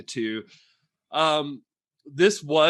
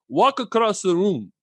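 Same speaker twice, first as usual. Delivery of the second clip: retold, hammed-up Italian accent, a bit higher and louder; bad Italian accent; 6 semitones higher, 5 dB louder than usual. Retelling the actress's director story, in a bad Italian accent. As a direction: mimic an Italian director's broken English, loosely. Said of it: "so I'm gonna do a very bad Italian impersonation".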